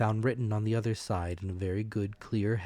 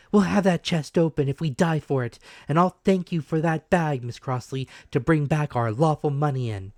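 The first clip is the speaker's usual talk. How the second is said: higher, louder, a brave scolding mother; mother's defiant voice; 7 semitones higher, 8 dB louder than usual. A narrator's reading. Mother scolding the villagers, high and loud. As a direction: shame the men into helping.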